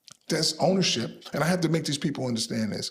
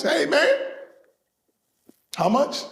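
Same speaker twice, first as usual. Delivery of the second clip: mock fee demand; 6 dB louder, slower than usual. Someone else demanding a fee.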